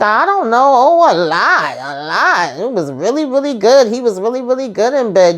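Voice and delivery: high, giddy, teasing woman's voice; high tipsy Nancy. Retold or imitated. Recapping the show, voicing Nancy confessing to Richard, in a high giddy voice. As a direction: play her as a giddy, oversharing flirt. Nancy gushing about the father.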